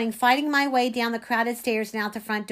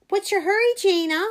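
high, loud teasing call-out; mocking holler at a fleeing classmate; taunting call; 9 semitones higher, 5 dB louder than usual. A narrator's reading. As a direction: taunt them as they run off. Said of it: "Anthony called after me, taunting me".

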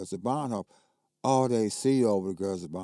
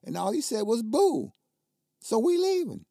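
retold angry protest; 12 semitones higher, 4 dB louder than usual. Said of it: "I said".

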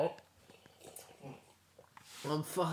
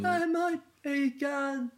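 high, loud cartoon greeting; loud, high-pitched Sassy impression; Sassy the Sasquatch; 6 semitones higher, 5 dB louder than usual. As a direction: hail a mate in a raised Sasquatch voice. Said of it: "you sound like sassy".

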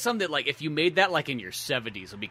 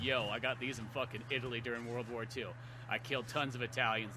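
low quiet menace; 3 semitones lower, 10 dB quieter than usual. Retold or imitated. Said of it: "being like".

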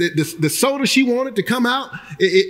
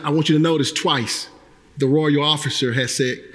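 ordinary talk

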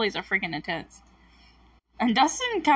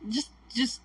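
desperate pleading; 6 semitones higher than usual. Retold or imitated. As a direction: beg your friend not to jump. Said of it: "And Dustin's like".

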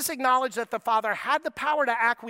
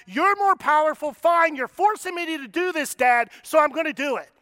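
resentful bullied son; 4 semitones higher, 6 dB louder than usual. Retold or imitated. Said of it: "not a tone of someone who's being bullied".

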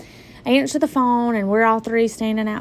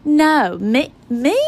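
sharp, louder disbelieving no; incredulous no; 4 semitones higher, 5 dB louder, slower than usual. Retold or imitated. To the doctor, in shocked disbelief. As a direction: refuse to believe it, voice jumping up.

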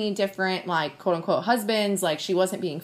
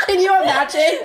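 high laughing disbelief, louder; 9 semitones higher, 8 dB louder than usual. Real, not acted. Friend laughs at the absurd idea, laughing. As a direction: laugh through the words.

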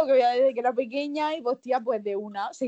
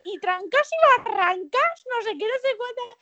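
silly high voice; 10 semitones higher than usual. Retold or imitated. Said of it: "en plan de".

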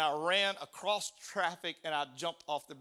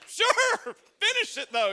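laughing; 11 dB louder, slower than usual. Retold or imitated. Imagined reply, laughing.

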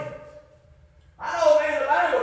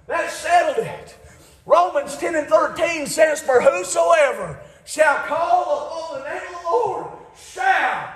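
full-force preaching; 4 semitones higher than usual. Acted. Preacher exhorting the congregation, full-force preaching.

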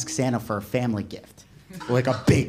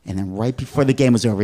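ordinary talk, nothing marked

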